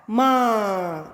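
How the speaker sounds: माँ with the long आ stretched out very slowly; drawn-out sound. Shown as a demonstration. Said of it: "तो होगा". (माँ को आ की मात्रा खींचकर धीरे बोलना)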